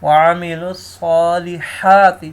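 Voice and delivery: الصالحات with a clear throaty ha; clear throat ha. Shown as a demonstration. Teacher shows the right ha in الصالحات, clear throaty sound. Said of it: "ہا کو clear کرنا ہے ہم نے".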